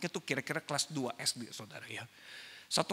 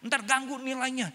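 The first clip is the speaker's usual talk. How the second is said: higher, louder objection; forbidding parent; 10 semitones higher, 8 dB louder than usual. Retold or imitated. Parents refusing to let the child join, forbidding. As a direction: object like a worried parent, pitched up.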